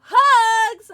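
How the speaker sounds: high, loud, drawn-out cry; exuberant call-out. Real, not acted. Streamer sending hugs to a sad viewer, exuberant shout. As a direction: throw it out big and high.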